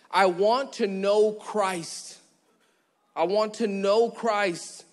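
slow, drawn-out plea; slow longing. Retold or imitated. Preacher voicing Paul's longing, slow and drawn out. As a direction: stretch every word with longing. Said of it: "he's like".

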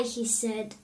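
ordinary delivery, nothing marked

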